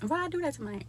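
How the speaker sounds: high, soft mock regret; regretful. Vlogger acting out regret over shrinkage, high soft whine. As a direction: whine about the haircut.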